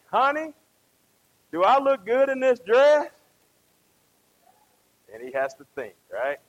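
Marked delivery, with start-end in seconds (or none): 0.1-3.1 higher, louder imitation of a wife; wife's question. Wife asking husband about her dress, higher and loud. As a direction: put on a wife fishing for a compliment.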